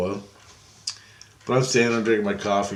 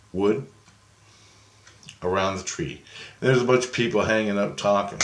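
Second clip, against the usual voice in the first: ordinary delivery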